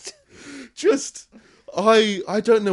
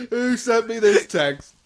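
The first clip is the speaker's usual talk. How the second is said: slow, louder, half laughing; laughing disbelief; 3 semitones higher, 7 dB louder, slower than usual. Retold or imitated. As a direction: drag it out through laughter. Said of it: "voicing the worker's reaction".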